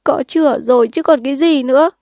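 crying. Acted. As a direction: cry it out in frustration. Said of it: "Sinh lại khóc".

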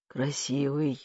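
low, soft, wry echo; wry chuckle. A narrator's reading. Frail uncle answering nephew's compliment, wryly soft. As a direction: echo it softly with faint irony. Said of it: "усмехнулся дядя Сёма".

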